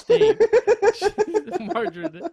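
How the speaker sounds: high, loud words squeezed through laughter; can barely get the words out for laughing. Real, not acted. Host giggling through his own margarine joke, laughing. Cracking up over silly names.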